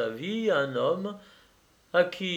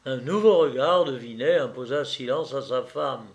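plain reading voice

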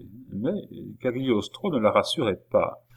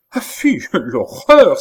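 much higher and louder, slow, with a laugh; exclaim with a laugh; 7 semitones higher, 10 dB louder, slower than usual. A narrator's reading. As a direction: cry out in laughing mock horror. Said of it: "s'écria madame du Barry".

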